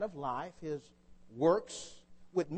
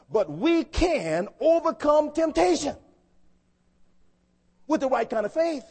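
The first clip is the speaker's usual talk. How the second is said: emphatic proclamation; 7 semitones higher, 7 dB louder than usual. Real, not acted. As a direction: proclaim it forcefully, stretching the words. Preacher proclaims victory over temptation.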